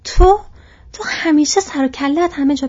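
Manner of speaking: loud, high cry of recognition; startled shout. A narrator's reading. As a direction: cry out in astonishment at an unwelcome face. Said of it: "فریاد زد".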